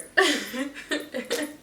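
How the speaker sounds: high-pitched burst of real laughter; laughing. Real, not acted. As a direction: crack up at yourself. Cracks up at own failed whispering.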